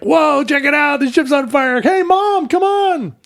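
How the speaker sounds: high, excited gawker voice; excited onlookers. Retold or imitated. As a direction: gasp at it like a thrilled crowd. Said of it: "everybody's like".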